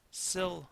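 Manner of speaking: "Syllable" broken up, first beat high and strong; stress on first syllable. Shown as a demonstration. Teacher showing where the accent falls, stressing SYL in "Syllable". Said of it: "pronounced with an accent on the first".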